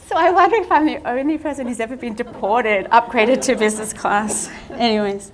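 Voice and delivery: amused disbelief spilling into laughter; laughing while talking. Real, not acted. Still laughing after the punchline.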